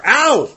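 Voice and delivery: sharp high ow; pained yelp. Acted. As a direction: yelp as if just hit. Being hit during the recording.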